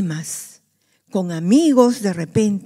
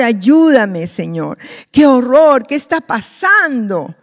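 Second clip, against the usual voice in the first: mock panicked shouting; 3 semitones higher, 7 dB louder, slower than usual. Disciples shouting to the Lord for help, loud. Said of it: "todos empezaron a gritar".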